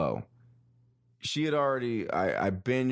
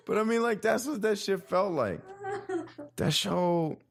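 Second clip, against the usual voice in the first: lingering choked-up voice; 12 semitones higher than usual. Shown as a demonstration. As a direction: still high, a trace of the strain. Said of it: "talking like this".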